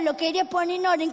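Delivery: usual speaking voice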